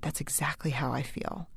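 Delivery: put-on soft, breathy whisper of a young girl; barely voiced, hushed confession; daughter's hushed reply. Daughter whispers to mom in the dark, hushed.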